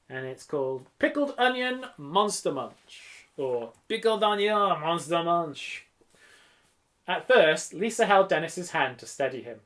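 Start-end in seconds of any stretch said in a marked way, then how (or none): 3.4-5.8 slow, hammed-up French accent; drawn-out French vowels and stresses. Shown as a demonstration. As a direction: turn the title into mock French. Title repeated in mock French.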